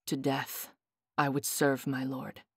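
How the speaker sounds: low, quiet and grave; a soldier's hushed oath, deep and soft; low solemn vow. Acted. Leander's solemn vow to king.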